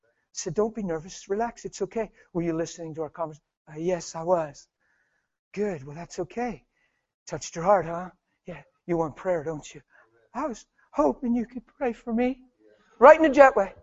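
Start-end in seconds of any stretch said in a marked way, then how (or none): 0.5-2.1 hushed, tender reassurance; calm a jittery stranger very softly; gentle, much softer voice; soothing. Retold or imitated. Soothing a nervous stranger, acted out. 2.3-12.4 soft, tentative back-and-forth; very quiet, gentle and hesitant; hushed exchange. Retold or imitated. Tender acted exchange with nervous stranger.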